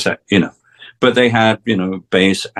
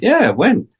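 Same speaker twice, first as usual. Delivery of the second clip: put-on eager young self; 10 semitones higher than usual. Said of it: "And I went".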